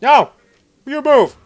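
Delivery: high panicked yelling; panicking gamer. Real, not acted. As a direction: yell in a frantic rush.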